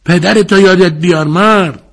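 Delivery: high, loud plea with fear creeping in; a desperate ringside shout edged with panic. A narrator's reading. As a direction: cry out, suddenly scared he'll lose. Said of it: "ترس در صدایش موج زد".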